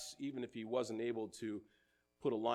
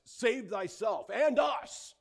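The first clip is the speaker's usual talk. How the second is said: high, loud and slow taunt; loud mocking jeer from the cross; jeering cry; 10 semitones higher, 8 dB louder, slower than usual. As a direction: fling the taunt up at him. Criminal jeers at Jesus.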